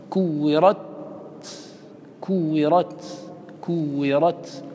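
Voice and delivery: recited كورت with an airy, vowelless final ت; stopped sound on ت. Shown as a demonstration. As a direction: end كورت with the voice cut and breath released. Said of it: "فالتاء لا يجري الصوت".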